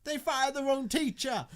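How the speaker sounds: high, loud shout of dismay; outraged cry. Acted. Character outraged at the news.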